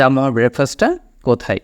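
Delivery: ordinary talk, nothing marked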